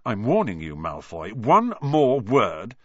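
slow, loud warning; threatening. Acted. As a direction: threaten him through clenched teeth. Ron threatens Malfoy.

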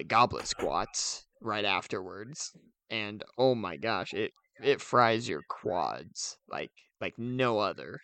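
ordinary talk, nothing marked

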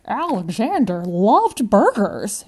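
a widow savouring her husband's name; elderly lady, slow and fond; old lady. Acted. Grandma Jo remembers husband.

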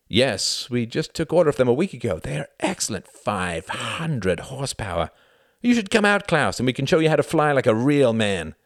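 high, eager bragging voice; boastful pilot. A narrator's reading. Pilot inviting Klaus out to fly, boastful.

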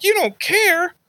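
very high mock-grandma disbelief; incredulous grandma. Grandma shocked grandson doesn't care, high incredulous imitation. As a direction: echo it back in shocked falsetto.

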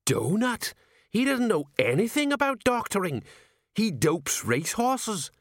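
put-on high, anxious old sergeant voice; a fretful sergeant aghast at the choice; Sergeant Colon. Sergeant protests to his commander, high and anxious.

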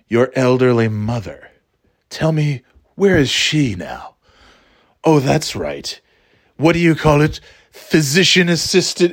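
mock sinister villain. Voicing the sinister film character.

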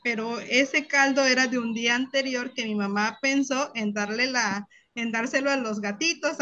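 ordinary talk, nothing marked

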